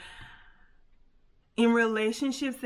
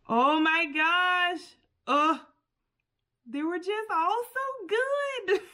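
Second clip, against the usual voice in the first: slow, high, louder "oh my gosh" with a laugh; overwhelmed gush; 9 semitones higher, 4 dB louder, slower than usual. Real, not acted. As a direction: gush with real delight, drawn out. Overwhelmed by the affirmations.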